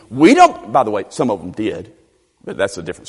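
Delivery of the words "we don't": high-pitched, pompous mock indignation; hammed-up Pharisee arrogance; dripping with ego. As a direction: sneer as a self-important religious leader. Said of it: "this just drips with ego".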